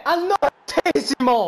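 high, loud and slow; goal shout. Acted. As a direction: shout the minute in triumph. Co-host shouts the minute.

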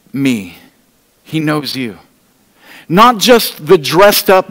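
usual speaking voice

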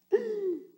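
high startled gasp; sharp gasp. Acted. Disciples gasping as Jesus eats, high sharp gasp. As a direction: gasp in shock. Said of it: "Everyone gasped".

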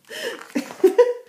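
high, loud laugh; short burst of laughter. Real, not acted. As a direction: laugh out brightly. Laughs at own aside.

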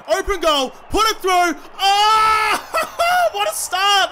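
high, loud urging bursting into a laughing cheer; thrilled gamer yell; elated shouting. Real, not acted. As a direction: cheer wildly at an early goal. Early goal celebration.

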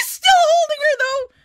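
high, loud thrilled shout; excited shout. Real, not acted. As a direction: call it out, overjoyed. Fan squealing at anime scene.

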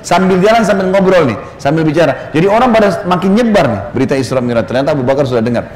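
ordinary talk, nothing marked

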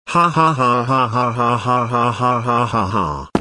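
a long cartoon villain cackle; evil laugh. Kid cackling over his rant plan, evil laugh. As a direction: cackle at your own wicked plan.

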